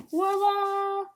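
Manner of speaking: high, loud ta-da flourish; showy reveal. Real, not acted. Vlogger showing viewers a Funko Pop, high triumphant reveal.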